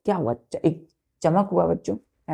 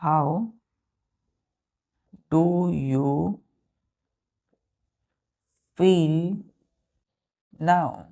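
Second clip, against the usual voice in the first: very slow, long gaps between words; measured board-writing pace, pausing after each word; word-by-word dictation; much slower than usual. Shown as a demonstration. Dictating example sentence word by word.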